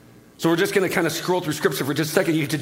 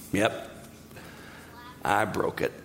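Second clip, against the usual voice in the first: Satan admitting it; 9 semitones lower, 8 dB quieter, slower than usual. Acted. Satan nodding, admitting the fall.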